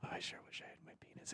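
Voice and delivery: whispered into the microphone; breathy unvoiced whisper, no tone. Shown as a demonstration. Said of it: "I can't hear when you whisper".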